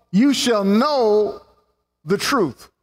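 weighty scripture emphasis; slow emphatic. Acted. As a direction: stress every word slowly. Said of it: "emphatic slow quote".

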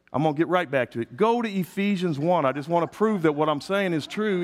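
normal talking voice

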